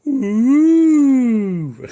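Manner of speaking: teasing crowd ooh; mock pub ooh. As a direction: tease like a whole pub reacting to a posh order. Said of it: "everybody in the pub just went".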